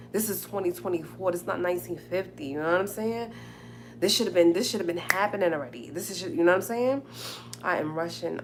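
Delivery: ordinary delivery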